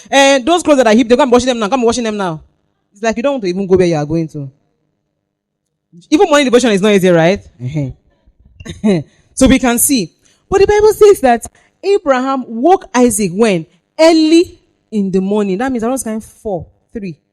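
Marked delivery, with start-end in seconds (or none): 0.1-2.4 loud, high nagging parent calling orders. Retold or imitated. A preacher voicing a parent nagging a teenager over unwashed clothes, loud and high. 6.1-15.6 loud, high, fast preaching; full-force preaching. Acted. A preacher rousing the congregation about waking teenagers, loud and high.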